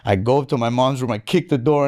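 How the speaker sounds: normal talking voice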